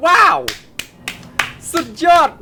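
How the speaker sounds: high, loud cry of wonder; amazed. Real, not acted. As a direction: let out a big wow. Awed by dragon flight.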